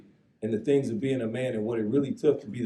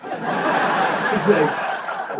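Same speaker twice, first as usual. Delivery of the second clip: laughing; 6 semitones higher, 7 dB louder than usual. Real, not acted. Laughing at the memory.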